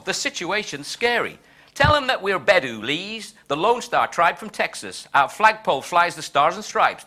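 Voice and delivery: rhymed grumbling in a broad put-on Texan accent; George Bush, mock Texan. President complaining to his envoy about Gulf allies, mock Texan. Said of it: "my appalling Texan accent".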